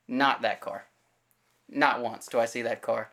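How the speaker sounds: ordinary talk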